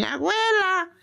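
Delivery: high little voice calling grandma; child calling out. Retold or imitated. Grandchild running up, calling out to grandma, lightly voiced. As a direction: call out like an excited grandchild.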